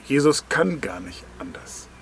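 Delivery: ordinary delivery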